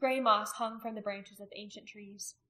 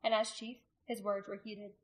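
ordinary delivery, nothing marked

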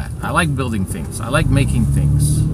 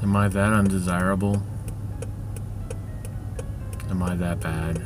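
low, quiet and dejected; sad; 5 semitones lower, 12 dB quieter than usual. Real, not acted. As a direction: ask it in despair. Despairing self-questioning.

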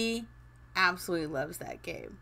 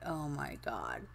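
low, soft, slow mutter to herself; quietly uneasy under her breath; lower, softer worried murmur; worried aside; 9 semitones lower, 10 dB quieter, slower than usual. Retold or imitated. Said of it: "I'm like".

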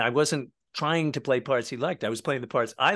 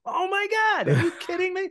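high, excited mock gasp; stunned disbelief. Starstruck fan retelling meeting the Layla drummer, high and giddy.

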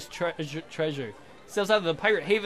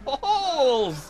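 high, gleeful cry through laughter; laughing exclamation; 8 semitones higher, 4 dB louder than usual. Pretend. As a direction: crow the word with a laugh. Repeats own lewd joke, laughing.

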